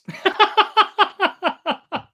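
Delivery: a burst of high, loud laughter; sudden bright cackle after a sip. Real, not acted. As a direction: crack up loudly at the strong drink. Tasting strong port cocktail.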